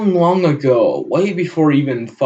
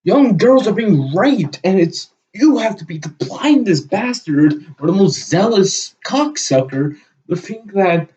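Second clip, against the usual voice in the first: furious ranting; 8 semitones higher than usual. Real, not acted. Vlogger yells at the man he disagrees with, furious. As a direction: let real anger boil over and keep it there. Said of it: "Even I'm getting fucking pissed off".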